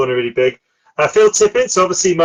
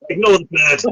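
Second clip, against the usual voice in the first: mock wizard dismissal; 3 semitones higher than usual. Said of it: "he just goes".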